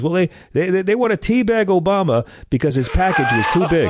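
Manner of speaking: dad voiced high and earnest; imitating dad. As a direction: put on a high-pitched dad voice for the punchline. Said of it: "He goes".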